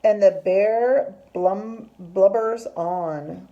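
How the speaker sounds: loud, slow, stretched refrain; story reading to children. A narrator's reading. Teacher reads that bear keeps crying, slow emphatic narration. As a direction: draw out the refrain for young listeners.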